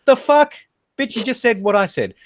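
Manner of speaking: high, exasperated retort; indignant outburst. Retold or imitated. Customer snapping back at the server, high-pitched indignation. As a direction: burst out in retold outrage. Retold quarrel with fast food worker.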